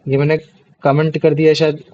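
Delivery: usual speaking voice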